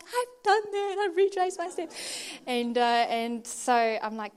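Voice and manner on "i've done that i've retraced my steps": high, loud mock protest; hammed-up exasperation; exasperated retort. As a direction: protest to your husband, pitched way up. Acted exasperated retort to husband.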